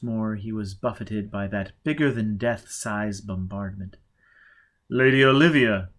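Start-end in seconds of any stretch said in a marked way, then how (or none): 4.9-5.9 calls her name loudly across the garden; calling out. A narrator's reading. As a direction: call out to her over the noise. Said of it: "he called".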